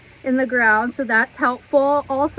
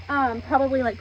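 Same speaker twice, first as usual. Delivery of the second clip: ordinary talk, nothing marked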